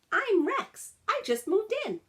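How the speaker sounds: put-on eager young dog. A narrator's reading. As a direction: chirp it out like a friendly little pup. Rex, a new dog, answers.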